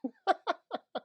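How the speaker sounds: sudden high-pitched laugh. Real, not acted. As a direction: burst out laughing. Laughs at own pirate sound.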